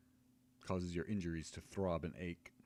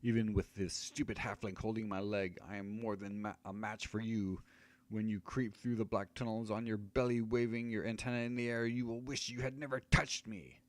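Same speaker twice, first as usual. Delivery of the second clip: menacing wizard; 5 semitones higher, 4 dB louder than usual. Acted. Wizard threatens the fighter.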